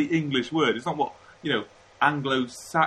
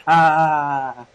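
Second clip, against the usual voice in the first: one loud, put-on "ha"; a sudden loud burst of pretend laughter; mock laugh; 2 semitones higher, 9 dB louder than usual. Imagined Japanese listeners react to the beer-or-tea answer, mock laugh.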